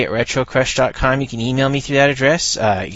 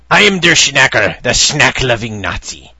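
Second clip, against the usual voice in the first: loud hammed-up mock German accent; 7 dB louder than usual. Reading a comic panel aloud, loud hammed-up mock German accent. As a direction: boom it out like a comic German villain. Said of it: "and it says".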